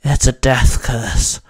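slow, forceful grunt of a line. A narrator's reading. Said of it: "Hex grunted".

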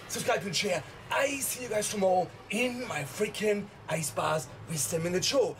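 usual speaking voice

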